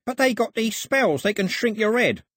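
very high, frightened protest; spooked henchman, voice pitched high; panicky. A narrator's reading. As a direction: plead in superstitious alarm. Panicky Polgrave fears native spells.

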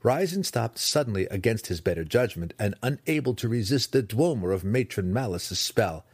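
ordinary delivery

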